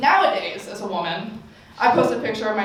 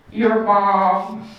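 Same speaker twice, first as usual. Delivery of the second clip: smirking comeback; 4 dB louder than usual. Retold or imitated. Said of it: "he smirks and he goes".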